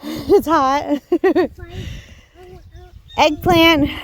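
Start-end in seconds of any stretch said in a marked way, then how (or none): 0.0-1.5 amused, laughing repeat; chuckling back at her daughter. Real, not acted. Mom laughs echoing her child.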